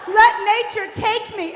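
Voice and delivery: high, loud melodramatic wail; screaming mother. Retold or imitated. Mother giving herself up to nature, high hammed-up scream. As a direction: imitate a mother hollering her last words. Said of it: "she's screaming".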